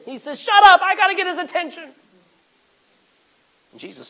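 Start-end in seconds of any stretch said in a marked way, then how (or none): 0.4-1.8 loud, high, quick snap back; urgent retort. Acted. Blind beggar to those hushing him, urgent shout. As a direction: shout them down, desperate to be heard.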